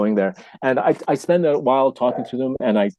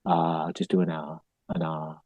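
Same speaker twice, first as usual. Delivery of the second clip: very soft, low, slowed instruction; breathy guiding demo; 5 semitones lower, 9 dB quieter than usual. Shown as a demonstration. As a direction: sigh out an easy vowel, guiding softly. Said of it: "start with a breath".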